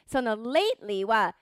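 usual speaking voice

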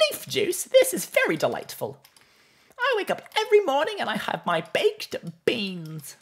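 very high-pitched character voice; Mister Whiskers. Acted. Streamer riffing on leaf juice, in Mister Whiskers voice. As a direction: push the voice right up, in character. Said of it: "in the Mister Whiskers voice".

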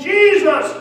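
high, full-throated shout; yelling at the top of his lungs. Acted. Blind beggar yelling to Jesus at the top of his lungs. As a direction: hurl the name across the crowd. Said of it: "he yelled at the top of his lungs".

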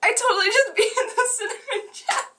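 high, fast, giggly boast; laughing. Real, not acted. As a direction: laugh while bragging. Celebrates beating the challenge.

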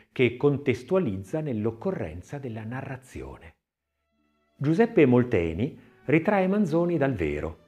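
plain reading voice

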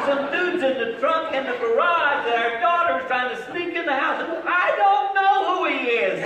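high, loud, laughing as he tells it; excited disbelief. Retold or imitated. Husband telling his wife about the boy in the trunk, high and loud. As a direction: blurt out the crazy news.

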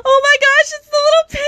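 high, loud excited squeal; squealing with delight. Real, not acted. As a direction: gush over the cute penguin. Squealing over cute toy penguin.

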